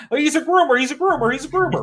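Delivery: high, sing-song mock repeating groomer; mocking accusers. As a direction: parody a mindless accuser chanting.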